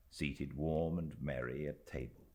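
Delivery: usual speaking voice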